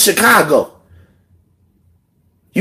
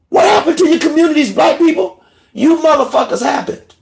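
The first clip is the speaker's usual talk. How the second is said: furious outburst; 10 semitones higher than usual. Real, not acted. Angry rant climax.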